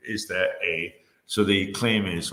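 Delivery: normal talking voice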